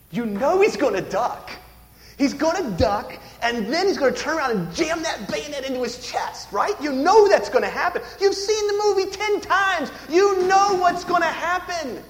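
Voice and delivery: high-pitched, building preacher fervor; impassioned preaching. Real, not acted. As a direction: build the suspense, rising in pitch.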